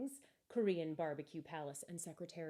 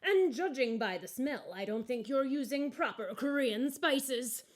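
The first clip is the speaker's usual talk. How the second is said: higher, louder, politely pointed little voice; lump of coal character; 5 semitones higher, 7 dB louder than usual. Acted. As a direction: correct the owner with gentle cheek.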